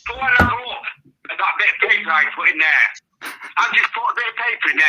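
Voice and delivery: overjoyed, high and loud; excited. Real, not acted. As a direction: burst out with happy disbelief. Dad hears derby win.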